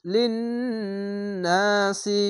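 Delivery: লিন্নাসি with long humming nn; nasal ghunna. Shown as a demonstration. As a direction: hold the nn of লিন্নাসি through the nose. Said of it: "এখানে ওয়াজিব গুন্না". (লিন্নাসি গুন্না দিয়ে)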